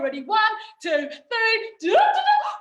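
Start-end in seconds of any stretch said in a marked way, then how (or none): 1.8-2.5 high, loud fanfare; triumphant reveal. Acted. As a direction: sing out a big ta-da.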